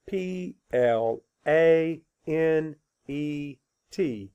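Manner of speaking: letters spelled out slowly; slow spelling. Shown as a demonstration. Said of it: "Spell it".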